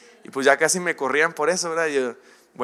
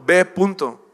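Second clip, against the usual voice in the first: louder, slow, stern order; firm command from God; 4 semitones higher, 5 dB louder, slower than usual. Acted. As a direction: lay down the order with no room to argue. God answers excuses firmly.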